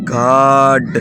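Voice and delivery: گاڈ with a drawn-out AA; long AA sound. Shown as a demonstration. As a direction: say گاڈ as "gaad", vowel held. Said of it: "الف مد آ کی آواز ہے جی". (گاڈ میں الف مد آ کی آواز)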